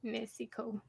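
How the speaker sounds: Mexico echoed softly as "MESS-ee-co"; playful mispronunciation. Retold or imitated. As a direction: softly say Mexico without the k, "Messico". Echoes the altered pronunciation.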